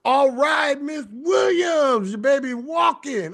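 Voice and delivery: high, drawn-out happy call; excited praise. Real, not acted. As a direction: call her name out with delight. Celebrating Miss Williams' progress.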